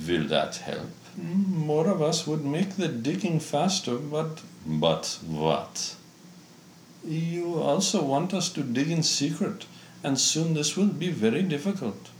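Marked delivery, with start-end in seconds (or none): 1.2-12.1 lightly voiced high minion; a hint of a squeaky underling; high creature voice.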